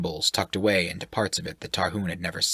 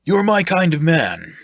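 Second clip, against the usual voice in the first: loud, higher, hearty; jovial sailor-type voice; 10 semitones higher, 7 dB louder than usual. Acted. Shirk flatters the sailor, loud and jovial. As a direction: boom it out like a jolly brute. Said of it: "roughly slapping the man on the back".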